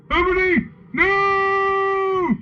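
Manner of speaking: high, loud falsetto wail; scream. A narrator's reading. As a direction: scream it out, dragging the last cry. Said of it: "screamed Draco".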